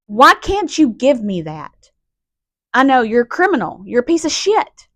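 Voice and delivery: real, heated confrontation; angry pleading. Real, not acted. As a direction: plead angrily with the one who wronged you. Angry plea to mother's suspected killer.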